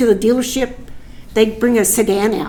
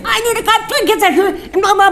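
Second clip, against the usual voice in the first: high, laughing mock shout, much higher; half-laughing imitation of a furious rant; mock furious yell; 10 semitones higher than usual. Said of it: "went cuckoo".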